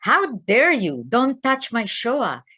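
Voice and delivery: outraged, drawing the line; a sharp, indignant outburst; indignant exclamation. A narrator's reading. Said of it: "exclaimed Ludmila".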